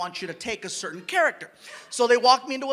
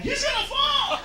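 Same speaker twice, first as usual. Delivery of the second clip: very high squeal of warning; mock alarmed cry; 3 dB louder, slower than usual. Onlookers backing away from a drunk, mock high alarm. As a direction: imitate panicked onlookers in falsetto.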